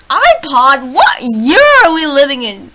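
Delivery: very loud, slow disbelief; incredulous outburst. Real, not acted. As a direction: belt out each word in disbelief. Mock disbelief at dated iPod mention.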